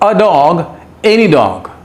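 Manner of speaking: usual speaking voice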